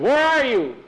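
high, loud call; searching shout. Acted. Hugo searching for Nikolai, loud searching shout. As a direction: holler high for a missing friend.

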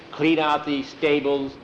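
usual speaking voice

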